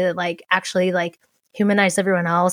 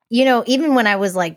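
ordinary talk

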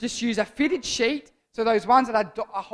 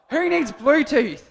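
mock infomercial; 5 semitones higher, 6 dB louder, slower than usual. Jokey repeated mock pitch.

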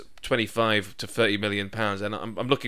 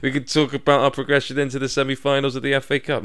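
laughing; 4 semitones higher, 6 dB louder than usual. Real, not acted. Laughing excitedly about cup run.

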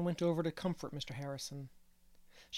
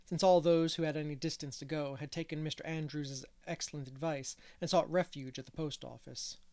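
ordinary delivery, nothing marked

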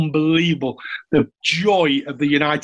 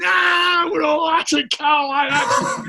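comic anguished cry from a car; hammed-up wail; 12 semitones higher, slower than usual. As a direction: wail it out in mock despair. Tufty crying out at the fans.

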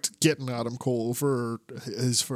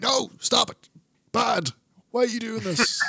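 high, scolding teacher voice; mock scolding; 8 semitones higher than usual. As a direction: tell them off like naughty kids. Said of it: "he's like".

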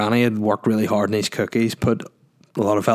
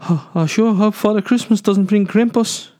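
much higher, hammed-up South African accent; bad South African accent; 11 semitones higher than usual. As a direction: ham up a South African child. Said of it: "worst South African accent ever".

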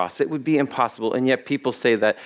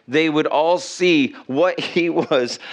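ordinary talk

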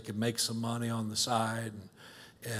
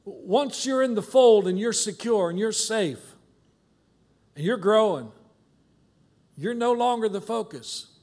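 louder, higher preaching push; 7 semitones higher, 5 dB louder than usual. Acted. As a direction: drive the point home from the pulpit. Preaching builds.

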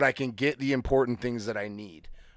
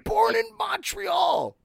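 much higher, slow, shouted disbelief; incredulous; slower than usual. Real, not acted. Disbelieving that his Canadian co-host speaks no French, shouted. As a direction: born in Montreal, come on!.

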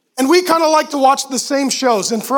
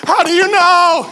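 loud, higher worried question; anxious demand; 5 semitones higher, 5 dB louder than usual. Retold or imitated. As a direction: push back in a panic.

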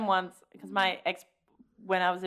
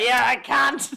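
laughing; 8 semitones higher, 7 dB louder, slower than usual. Retold or imitated. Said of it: "being like".

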